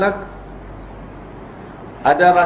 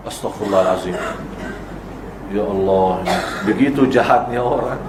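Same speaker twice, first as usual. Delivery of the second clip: low dismay; 9 semitones lower, 4 dB quieter than usual. Real, not acted. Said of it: "dismay at cruelty".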